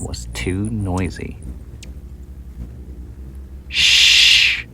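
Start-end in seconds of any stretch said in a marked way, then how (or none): 3.7-4.7 long, loud hissed shh; a strong, drawn-out shh; big shush. Acted. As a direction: silence everyone with one loud shh. Daisy shushes all the insects.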